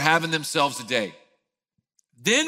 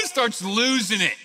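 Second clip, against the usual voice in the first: usual speaking voice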